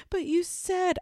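put-on high, whiny kid voice cutting in; daughter's protest. Mom mimicking her daughter's protest, high whiny child voice. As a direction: mimic the child's "but you said" whine.

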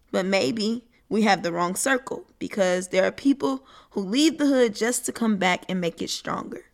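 normal talking voice

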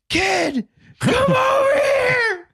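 put-on old guy shouting. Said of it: "like, a old guy".

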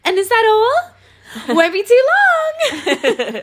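very high, slow singsong server; high customer-service voice. Retold or imitated. Server closing an order, exaggerated high voice. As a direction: draw it out high and sugary. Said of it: "my voice goes up quite a few octaves".

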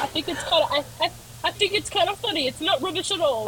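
hammed-up British accent; put-on British accent. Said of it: "keep this accent".

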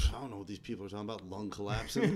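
quick and quiet; fast mumbled quote. Retold or imitated. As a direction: rattle it off low. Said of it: "He's like".

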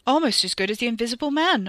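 hearty, projected jest over the room; booming. A narrator's reading. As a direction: boom it out to break the tension. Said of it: "Uncle David boomed".

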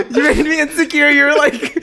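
high, loud giggly words; laughing. Real, not acted. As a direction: laugh through the whole line. Laughing after being teased.

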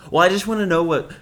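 high, loud, rushed mimicry; imitated demanding date. Date texts demanding reasons, imitated insistence. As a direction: push it out fast and high, imitating her.

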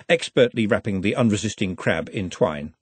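plain reading voice

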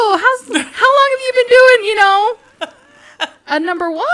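high, loud, forced chattiness; hammed-up awkward conversation opener; awkward small talk. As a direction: stumble brightly into nervous small talk. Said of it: "trying to talk to him awkwardly".

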